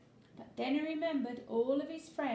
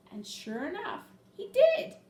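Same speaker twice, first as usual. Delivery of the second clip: much higher and louder, bursting out; triumphant ending; 8 semitones higher, 7 dB louder than usual. A narrator's reading. Narrator telling children the happy ending, joyful burst. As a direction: finish the story with a joyful burst.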